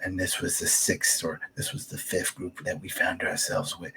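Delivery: soft, breathy inner narration, as if to herself; hushed narrator voice. Pretend. Podcaster imagines Alpha narrating to herself, hushed. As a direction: murmur it like a story narrator. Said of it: "it would have been great if she would have said".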